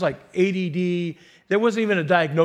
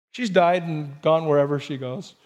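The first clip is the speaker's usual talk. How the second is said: ordinary delivery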